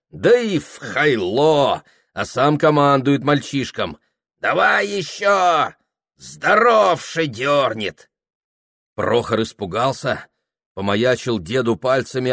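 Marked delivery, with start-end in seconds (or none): 4.4-8.1 put-on high, slow old man barking orders; old man commanding. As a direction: drive the boys on, slow and high.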